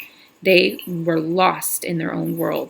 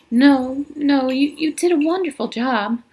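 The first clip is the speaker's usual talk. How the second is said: higher, lightly voiced young teacher; a hint of the flustered teacher, pitched up; Catherine voiced higher; 8 semitones higher than usual. Catherine reassures Sam, voiced high.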